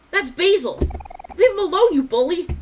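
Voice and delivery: high, loud yell at a bully; angry shout. Acted. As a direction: shout him down to defend a friend. Kel yells at the bully.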